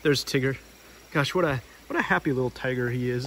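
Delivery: usual speaking voice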